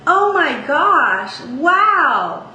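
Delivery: speech-pitch exclamation, held long. Shown as a demonstration. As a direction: stretch it out up high like real amazement. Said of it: "all of the pitches that you use when you talk".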